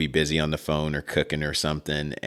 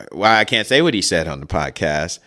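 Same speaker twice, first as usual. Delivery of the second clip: normal talking voice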